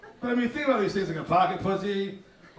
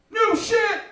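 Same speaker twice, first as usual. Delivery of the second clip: much higher, loud barked hype yell; hype man shout; 13 semitones higher, 5 dB louder than usual. Retold or imitated. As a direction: bark it out like a rap hype man. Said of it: "hype man that would be like".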